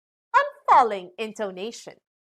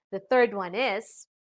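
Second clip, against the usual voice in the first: ordinary talk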